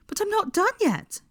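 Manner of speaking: high, fretful protest. Acted. Hermione protesting bedtime to Draco, high fretful. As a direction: whine that you're not finished. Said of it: "said Hermione fretfully".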